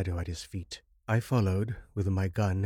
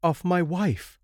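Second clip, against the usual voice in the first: high, louder, urgent answer; impatient reply; 10 semitones higher, 5 dB louder, slower than usual. A narrator's reading. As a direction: snap it out in worried haste. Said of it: "I replied impatiently".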